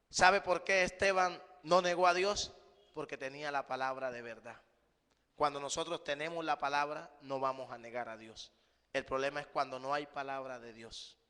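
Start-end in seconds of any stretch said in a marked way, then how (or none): none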